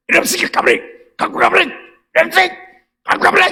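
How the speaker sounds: high, strained screech in bursts; imitated furious screaming. Friend shouting at late bed deliverers, mock screaming. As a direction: mimic someone throwing a screaming fit. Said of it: "막 악을 쓰고".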